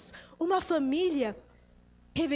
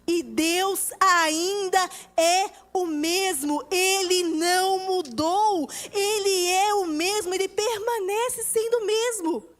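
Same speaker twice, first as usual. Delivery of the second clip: slow, weighty words building higher; emphatic preaching; 3 semitones higher, 4 dB louder than usual. Acted. Preacher proclaiming that God is unchanged, emphatically. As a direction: proclaim it with full conviction.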